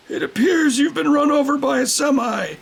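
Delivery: high loud shouted diagnosis; hammed-up TV doctor shout; doctor shouting a grim diagnosis at the patient.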